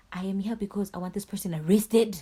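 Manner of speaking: loud, insistent retort; retold anger, punched loud towards the end; mad. Fed-up victim telling police why she came, mad. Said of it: "So now I'm mad".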